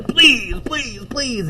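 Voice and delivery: very high squealed begging; frantic pleading. Acted. Boy begging as he squeezes the cat, frantic high pleading. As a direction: squeeze out each high please.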